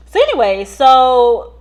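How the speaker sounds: loud, punchy "so anyway"; emphatic. Real, not acted. Vlogger pushing the story on, emphatic. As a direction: slam the story forward.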